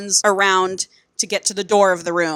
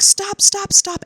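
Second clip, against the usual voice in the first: high, urgent retold cries; mock frantic plea; 13 semitones higher, slower than usual. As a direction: imitate a mother begging him to stop. Mother pleads with violent dad.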